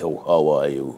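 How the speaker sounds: deep, low put-on American greeting; guttural American voice. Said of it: "who have that guttural voice".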